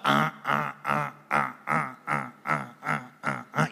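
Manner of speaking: long run of low, evenly spaced honks; imitated goose honking. Mother goose calling all day long, relentless low honking. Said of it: "la mamá está".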